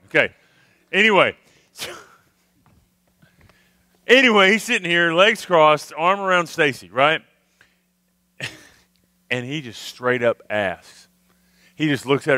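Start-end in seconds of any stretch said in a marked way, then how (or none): none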